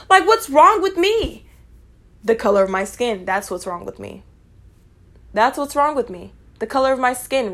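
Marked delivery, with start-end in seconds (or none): 0.1-1.5 high, slow mock question. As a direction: ask it in their whiny tone. Mocking gossipers' question.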